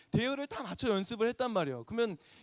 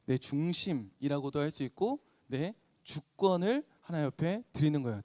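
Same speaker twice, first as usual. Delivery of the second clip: ordinary talk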